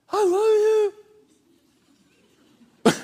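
high, airy mock tenderness; mock sweet "I love you". Preacher teasing the congregation with a sugary love note. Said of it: "It wasn't like".

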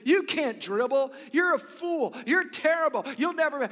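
put-on loud, high, harsh scolding of a kid; berating coach. Imagined coach berating a child, loud and harsh. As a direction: bark insults at the kid like a bad coach. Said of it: "a coach went up to a kid and said".

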